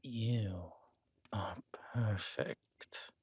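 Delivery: slow, hushed breathy praise; a slow purring whisper of a compliment; seductive whisper. Acted. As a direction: breathe it slowly into her ear. Husband seducing his new wife.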